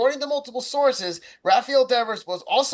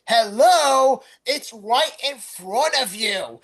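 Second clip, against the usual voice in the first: exasperated hello; 2 semitones higher, 4 dB louder, much slower than usual. Real, not acted. Exasperated at the manager.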